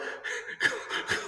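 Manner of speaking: breathy snicker, trying to hold it in; stifled laughter. Pretend. Teacher failing to act stern, snickering. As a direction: burst of suppressed giggling. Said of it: "but you're laughing".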